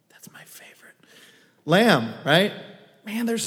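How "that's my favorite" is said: unvoiced confiding whisper; whispered aside. Real, not acted. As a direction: lean in and whisper it. Aside about lamb.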